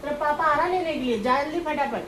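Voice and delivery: loud, high hurry-up; hurrying scold. Real, not acted. Aunt loudly telling her nephew his dad is coming.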